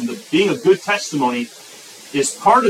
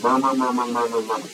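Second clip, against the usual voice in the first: mock murmuring; 5 semitones lower, 4 dB quieter than usual. Preacher mimics complainers again, mock murmuring. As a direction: lower grumbling mumble.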